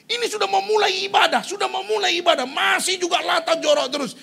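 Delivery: worked-up storytelling from the pulpit; exasperated preaching. Real, not acted. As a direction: exclaim loudly at the outrage. Exasperated retelling of disrupted worship.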